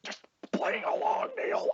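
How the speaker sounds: unvoiced whisper to a friend. Player whispers aside to Neil, unvoiced. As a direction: whisper it aside so nobody hears.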